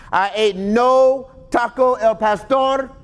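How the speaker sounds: high, slow prophet voice on taco El Pastor; mock Daniel. Preacher joking how Daniel read it, high and slow. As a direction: drag out "I ate no taco El Pastor" high and grand. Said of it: "He didn't say it like that".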